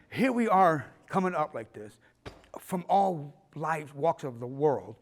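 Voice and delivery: slow Shakespearean declaiming; imitated grand stage oration; pompous, theatrical. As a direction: stretch the words like a pompous dean. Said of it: "he was very theatrical, very pompous, very Shakespearean".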